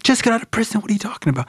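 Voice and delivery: high-pitched incredulous dad, acted out; alarmed disbelief. Dad to son on hearing of prison, alarmed. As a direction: squawk it back in disbelief.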